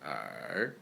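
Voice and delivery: er dropped very low, then rising; third tone. Shown as a demonstration. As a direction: dip er deep and soft, then lift. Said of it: "start from the second tone".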